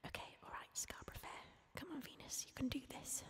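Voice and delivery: barely audible whisper to yourself; whispered pep talk. Real, not acted. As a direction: quietly coax yourself before singing. Psyching herself up to sing.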